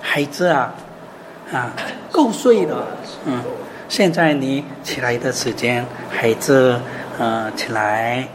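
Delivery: low, soft and slow, tender waking call; gentle voice. Shown as a demonstration. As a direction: softly coax a sleeping child awake. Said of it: "很温柔的声音".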